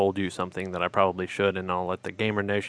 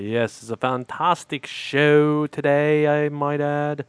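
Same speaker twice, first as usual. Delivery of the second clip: slow, higher hammed-up British accent; mock British accent; 6 semitones higher, 4 dB louder, slower than usual. Cohost answers in a bad British accent.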